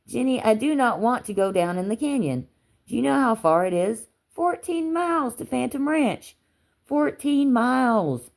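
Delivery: high, insistent objection; emphatic, high-voiced refusal, dragging out the distances; exasperated protest. A narrator's reading. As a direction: protest with rising pitch, spelling out the hardship. Protesting to Jenny about the trip.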